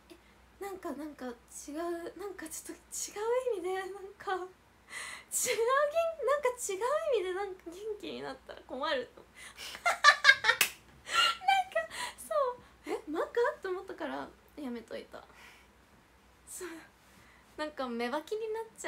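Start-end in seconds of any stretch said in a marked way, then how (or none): 4.3-13.3 high, giggling through the words; embarrassed giggle about the supplement; laughing. Real, not acted. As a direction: crack up while explaining. Laughing while explaining.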